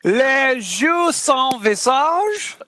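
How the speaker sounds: slow, careful French pronunciation; French accent. Real, not acted. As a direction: say it like a native Parisian. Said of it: "you sound so French, [name]".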